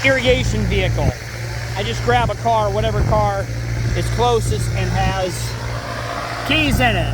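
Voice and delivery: loud, high, calling over engine noise. Real, not acted. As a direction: project over a roaring motor. Talking over a running engine.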